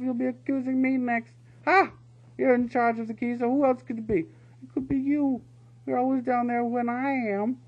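high, loud bickering weasel guards; two weasels snapping at each other; heated weasel squabble. A narrator's reading. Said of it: "They were arguing heatedly".

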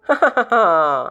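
short scornful chuckle; mocking laugh. A narrator's reading. Balan laughs at Prakashan's plea, scornful chuckle. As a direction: laugh him off with a snort. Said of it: "ബാലണ്ണൻ അത് കേട്ട് ചിരിക്കുകയാണ്".

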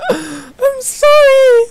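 very high, drawn-out loud wail; mock-wailed apology. Streamer apologising for a mishap in the game, mock wailing. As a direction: squeal it like hammed-up crying.

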